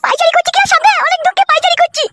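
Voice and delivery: high-pitched daughter-in-law, fretful. Acted. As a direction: play the worried daughter-in-law reporting her husband's arrest.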